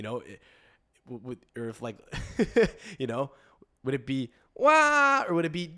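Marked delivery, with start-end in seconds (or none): 4.5-5.2 high, loud mock baby wail; baby cry. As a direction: wail like a newborn.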